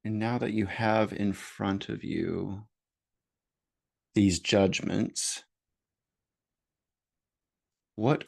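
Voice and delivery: slow, measured meditation voice with long pauses; guided meditation. Real, not acted. Host leading listeners into noticing their judgments, guided meditation voice. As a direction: guide gently, leaving space after each phrase.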